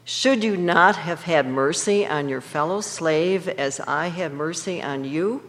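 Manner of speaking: usual speaking voice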